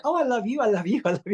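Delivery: high, gushing and laughing; lightly voiced sweet gushing televangelist; gushing Tammy Faye. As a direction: pour on the sugary love, giggling. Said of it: "she just was like".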